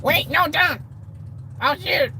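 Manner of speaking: high, loud pretend alarm; mock panic. Gunman warning he will shoot, mock panicked shout. Playing out a hold-up.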